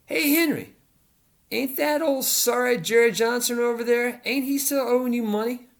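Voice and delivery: put-on high, twangy country-boy voice calling over his shoulder; Hard Rock voiced high. A narrator's reading. Hard Rock calls over his shoulder to Henry, high and teasing.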